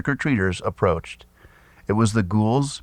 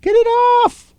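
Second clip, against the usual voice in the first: shrill panicked shriek, far higher and louder; 5 dB louder, much slower than usual. A narrator's reading. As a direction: squeal in terror as the spider lands. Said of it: "as Ula shrieked".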